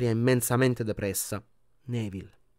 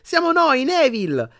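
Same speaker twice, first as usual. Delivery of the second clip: much higher, louder happy greeting; cheerful exclamation; 13 semitones higher, 5 dB louder, slower than usual. A narrator's reading. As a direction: call out gladly to a friend. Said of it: "esclamò Ron allegro". (Ron esclama allegro, voce alta)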